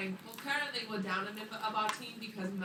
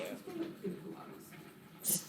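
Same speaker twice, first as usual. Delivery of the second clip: slow breathy whisper; 6 semitones higher, 11 dB quieter, much slower than usual. Real, not acted. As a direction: whisper it slowly. Whispered joke.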